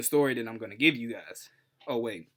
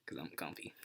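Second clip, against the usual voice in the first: whispered aside; 8 semitones lower, 15 dB quieter than usual. Real, not acted. Host confiding he feels comfy, whispered. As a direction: whisper 'Cause I'm comfy, much softer. Soft aside about comfort.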